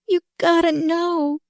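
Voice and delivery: put-on high, slow, straining girl; with effort. Dying girl insists to Marilyn, straining. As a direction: force it out with great effort. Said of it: "she broke forth again with effort".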